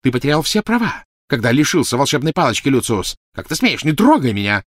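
high, loud tirade; furious, scornful. Acted. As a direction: lash out, then order hands off. Furious quarrel, woman fighting a grip. (яростно, высоким громким голосом)

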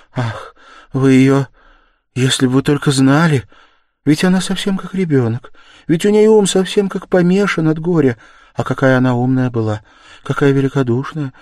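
high, agitated, suffering plea; despairing. A narrator's reading. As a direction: plead loudly as if in despair. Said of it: "проговорила это точно в отчаянии". (в отчаянии, волнуясь и страдая)

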